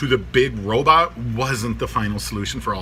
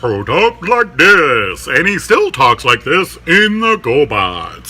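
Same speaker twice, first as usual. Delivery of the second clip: put-on booming old-time TV announcer, loud and drawn out; slow, loud, hammed-up announcer impression; Gary Owens; 6 dB louder, slower than usual. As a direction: stretch it out like a big show announcer. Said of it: "he's the announcer on the old Laugh-In show".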